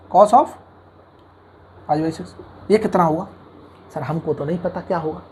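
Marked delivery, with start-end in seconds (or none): 3.9-5.2 lightly voiced student, lower and softer; a hint of a student quietly owning up; clueless student. Teacher answers for students who don't know, lightly voiced, low and soft.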